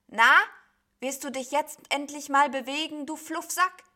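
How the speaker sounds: high, loud prodding; impatient scolding. A narrator's reading. Sheep orders the idle cloud beast to move, impatiently scolding. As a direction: needle them loudly to get moving.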